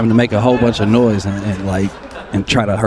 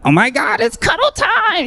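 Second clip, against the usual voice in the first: excited partner. Retold or imitated. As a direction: squeal it like a giddy partner, pitched way up. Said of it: "they be coming to bed excited, like".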